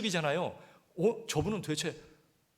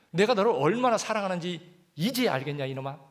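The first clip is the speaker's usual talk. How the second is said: loud, higher, forceful Lord's question; loud fond rebuke; 6 semitones higher, 7 dB louder than usual. Shown as a demonstration. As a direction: call it out like a loving scolding father. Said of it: "야".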